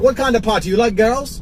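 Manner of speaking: lightly mocked Jamaican questioning; Jamaican accent. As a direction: put on a Jamaican driver's voice. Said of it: "He had that Jamaican accent".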